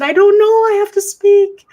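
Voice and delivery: urgent insistence. Retold or imitated. Wife tells husband she must speak, high and urgent. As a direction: push it out as if you must speak right now. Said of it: "I said".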